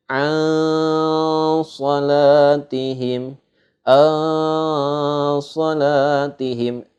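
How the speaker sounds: "An salatihim" chanted with the n veiled into a long nasal hum; ikhfa recitation. Shown as a demonstration. Teacher shows students how ikhfa is read, "An salatihim" with a long veiled n. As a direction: draw out a masked n in "An", then chant "salatihim". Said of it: "Kita cara bacanya adalah".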